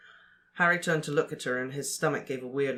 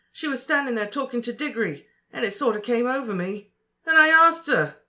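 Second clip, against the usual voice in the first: much louder and higher, panicked; frantic; 9 semitones higher, 7 dB louder than usual. A narrator's reading. Ron telling Harry about asking Fleur, frantic and loud. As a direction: pour it out in rising panic.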